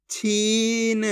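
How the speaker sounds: छीन slow with a long ee; drawn-out vowel. Shown as a demonstration. Teacher reads the joined word, छीन drawn out. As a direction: stretch the ई in छीन. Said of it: "जिस तरीके से मैं खींच खींच के पढ़ रहा हूँ".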